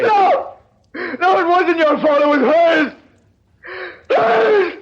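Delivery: anguished outburst. Acted. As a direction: cry out in anguish, rising on hers. Breaking down at parents' figures.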